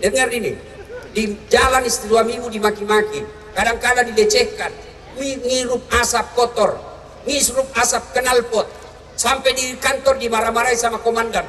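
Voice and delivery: usual speaking voice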